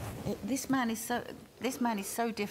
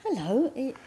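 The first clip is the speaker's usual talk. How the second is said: a higher, louder hello as the spirit of Paul; the spirit's hello; 8 semitones higher, 8 dB louder than usual. Retold or imitated. Spirit of her late husband greets her on the phone, higher and louder. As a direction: lift the hello up and out as Paul's spirit calling. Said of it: "and he said".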